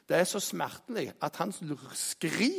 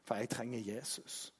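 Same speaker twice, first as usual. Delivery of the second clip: quiet plea; 4 semitones lower, 8 dB quieter than usual. Retold or imitated. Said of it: "Ringer til sin bror og sier".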